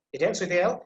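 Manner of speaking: ordinary delivery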